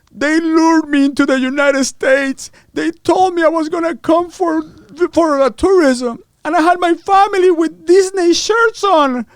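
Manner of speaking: high, loud, slow mock lament; indignant wailing. Duped man complaining in the Miami Herald, wailing. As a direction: wail it out like an outraged victim. Acting out the arrested man's complaint.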